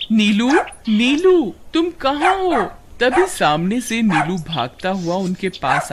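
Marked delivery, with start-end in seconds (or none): 0.1-2.6 raised, rising searching call. Acted. Suman searching for her dog, calling out. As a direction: call louder and higher for your pet.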